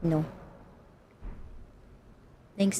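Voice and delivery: ordinary talk, nothing marked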